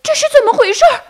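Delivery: very high, loud turtledove squawk; startled, high-pitched cartoon bird yell; baffled shout. A narrator's reading. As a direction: cry out bewildered in a tiny bird's voice. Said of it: "斑鸠在网里莫名其妙地大叫".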